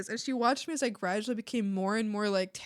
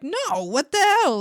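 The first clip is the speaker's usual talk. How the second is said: shocked retort; 7 semitones higher, 7 dB louder, slower than usual. Retold or imitated. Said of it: "And I'm like".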